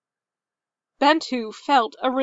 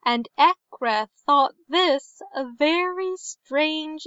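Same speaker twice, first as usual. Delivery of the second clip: higher, lilting storyteller's voice; folktale storytelling; 4 semitones higher than usual. A narrator's reading. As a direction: recite an old tale, drawing out phrase endings. Tells an old tale within the book.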